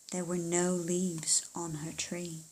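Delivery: slow hushed narration; breathless story whisper. A narrator's reading. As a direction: whisper it like a secret. Suspense line in story.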